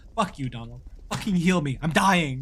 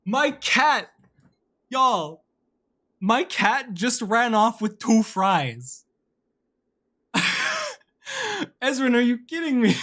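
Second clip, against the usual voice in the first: excited disbelief; 6 semitones higher, slower than usual. Real, not acted. Telling chat about cat.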